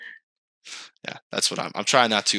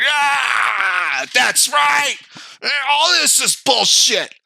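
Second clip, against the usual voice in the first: mock rage; 11 semitones higher, much slower than usual. Angry guy waking up to his grudges, hammed-up shouting. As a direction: rant like a monster waking, over the top. Acted rage, woken like Frankenstein.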